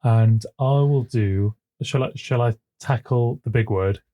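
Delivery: ordinary talk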